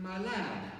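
lightly put-on Irish lilt; a touch of Irish in the voice; Irish accent. Saint Augustine greeting a little boy, light Irish accent. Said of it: "Sounds like an Irish accent, doesn't it?".

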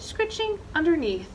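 plain reading voice

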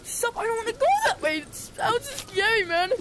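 high, loud frightened pleading; panicking as the player drifts away; real fright, voice up high and loud; scared. Real, not acted. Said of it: "it's making me scared".

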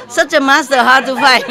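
laughing. Real, not acted. Bragging jokingly.

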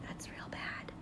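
teacher whispering to the class about the bad seed; whispered aside. Real, not acted. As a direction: lean in and whisper it.

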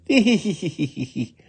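high hee-hee giggle; tittering laugh. Acted. Little woman taken by the Oni, high tittering. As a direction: giggle in a high, rippling run. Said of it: "laughed the little woman".

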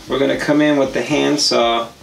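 normal talking voice

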